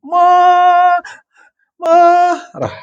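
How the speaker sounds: squeaky high mocking voice; mock high squeal. Mocking drawn-out anger word.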